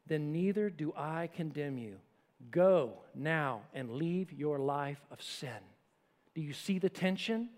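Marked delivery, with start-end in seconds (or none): 0.1-5.6 soft, slow and gentle; a lightly voiced compassionate Jesus. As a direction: speak it with quiet compassion. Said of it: "speaks to her so compassionately".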